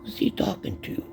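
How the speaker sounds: mock-puzzled inner voice, high and soft; soft, high retold aside to yourself; thinking to oneself. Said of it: "I thought to myself".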